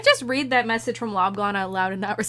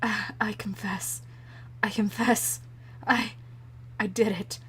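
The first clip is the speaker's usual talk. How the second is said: broken confession; 7 dB quieter, slower than usual. Acted. Witness confesses to hitting Miss Mia, broken and quiet. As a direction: falter quietly, as if defeated.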